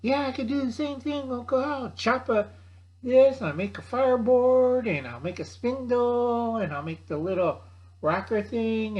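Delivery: put-on high, bragging kid voice, a notch up; nephew voice. Nephew brags to Uncle Al he can make fire, high kid voice. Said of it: "and they say".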